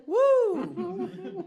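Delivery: high laughing whoop; woo. Real, not acted. Reaction after Amanda joke.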